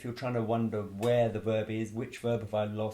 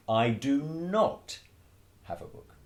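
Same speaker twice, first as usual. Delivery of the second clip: "I do not" slow and louder, "have a book" trailing off; emphatic negative; 5 semitones higher, 4 dB louder than usual. Shown as a demonstration. Teacher showing the negative to students. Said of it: "to create a negative".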